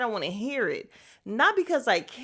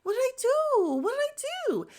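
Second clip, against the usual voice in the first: high, breathy mock-innocent protest, climbing; hammed-up high-pitched impression of Drew's innocence; imitating Drew; 12 semitones higher than usual. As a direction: play the wide-eyed innocent who did nothing. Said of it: "you know how Drew is always like".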